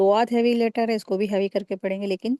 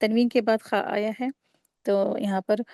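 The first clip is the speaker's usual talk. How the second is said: ordinary talk, nothing marked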